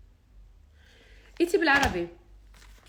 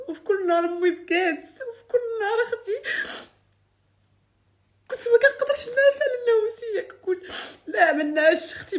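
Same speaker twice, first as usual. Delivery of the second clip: high, loud, sobbing through words; angry crying; 7 semitones higher, 5 dB louder than usual. Real, not acted. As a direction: weep and curse at once. Sobbing recounting nights, curse.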